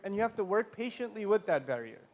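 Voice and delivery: normal talking voice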